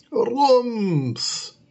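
booming thump sound; loud high rums effect. A narrator's reading. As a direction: boom it out like a bang.

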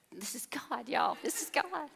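breathy whisper to the congregation; hushed confiding aside. Real, not acted. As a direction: lean in and whisper it.

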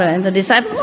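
normal talking voice

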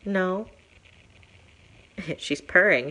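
ordinary talk, nothing marked

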